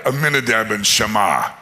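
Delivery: usual speaking voice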